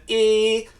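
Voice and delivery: a high fronted "e" to show the formant; bright e vowel. Shown as a demonstration. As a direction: say "e" with the tongue high and forward. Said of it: "which is a different format than".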